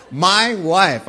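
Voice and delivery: MY hit loud and hard; stress on MY. Shown as a demonstration. Preacher fixing his dropped pronoun, loud stress on MY. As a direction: punch the word MY. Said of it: "I just dropped a little pronoun".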